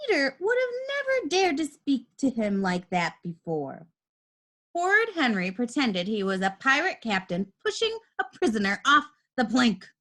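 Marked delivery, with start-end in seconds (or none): none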